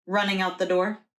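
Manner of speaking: ordinary delivery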